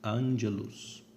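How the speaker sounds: "Angelus" slowly, stress on AN; tônica na antepenúltima. Shown as a demonstration. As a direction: lean on the first syllable: ANgelus. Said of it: "a antepenúltima é que é a tônica". ("Angelus" devagar, com ênfase no AN)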